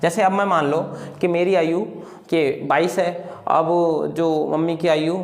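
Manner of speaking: normal talking voice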